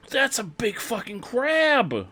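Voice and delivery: high, slow stunned exclamation; gasping at a giant crab; shocked. Real, not acted.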